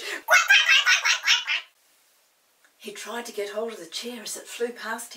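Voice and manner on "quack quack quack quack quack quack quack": very loud, high furious duck quacks; the Snuggle quacking loud and high with rage at the escaping children. Acted. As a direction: quack in a fury. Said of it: "He quacked with rage".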